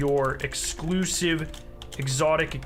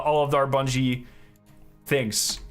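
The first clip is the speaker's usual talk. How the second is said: ordinary talk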